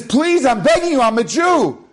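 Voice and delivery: high, pleading cry; desperate begging. Retold or imitated. Prisoner about to be shot begging the soldiers, high desperate plea. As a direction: plead for your life up high.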